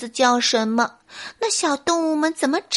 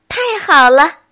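loud, higher burst of joy; delighted cheer; 5 semitones higher, 9 dB louder than usual. Acted. Little animals celebrate with the bear, overjoyed. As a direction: cheer as the whole gang.

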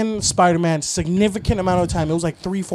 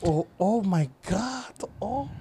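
slow, hushed gossipy reaction; soft, scandalized drawn-out gasp of gossip; 3 semitones higher, 6 dB quieter, slower than usual. Acted. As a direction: draw out the oohs over juicy gossip. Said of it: "if you're just gossiping".

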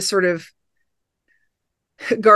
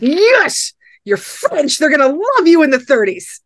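much higher, delighted mock cheer for the Frenchman; gleeful cheer; 9 semitones higher, 5 dB louder than usual. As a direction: cheer him on like a giddy fan. Excited imagined reaction.

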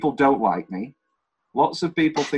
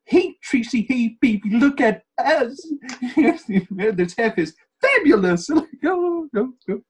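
Hairspray voice; 11 semitones higher, 4 dB louder, slower than usual. Retold or imitated. Quotes Hairspray scene.

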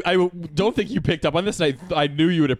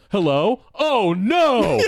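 higher, hammed-up mock alarm; badly faked phone bit; 5 semitones higher, 4 dB louder, much slower than usual. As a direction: act out an obviously fake bad-news call. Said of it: "as a bit gonna be like".

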